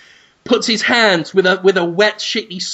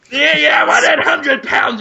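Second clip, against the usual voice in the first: mock demanding shout; 7 semitones higher than usual. Buyer shouts his demand at the agent. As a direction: insist like a spoilt kid. Squabble over money.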